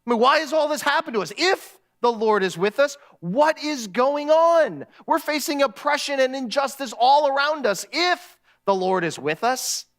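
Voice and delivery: acted exasperated protest; angry. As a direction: demand answers, pitch climbing on the questions. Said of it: "Gideon gets angry".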